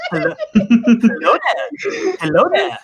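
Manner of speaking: slow, drawn-out greeting through a laugh; lightly voiced Obi-Wan greeting; Ewan McGregor impression. Taking up the impression contest, a slow laughing Obi-Wan hello. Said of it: "best Ewan McGregor impersonation".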